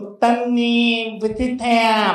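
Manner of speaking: slow, drawn-out aegyo mimicry; cutesy girl. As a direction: imitate a favourite pupil sweet-talking the pastor. 요셉이 같은 여자애의 애교 흉내.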